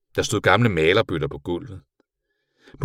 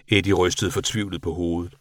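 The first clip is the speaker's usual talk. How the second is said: plain reading voice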